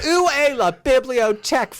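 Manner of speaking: high, bad English-speaker French; hammed-up schoolbook French; mock French. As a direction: ask for the library like a clumsy tourist. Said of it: "I said".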